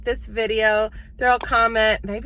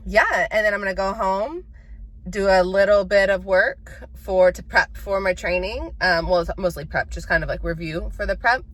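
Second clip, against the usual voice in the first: ordinary talk, nothing marked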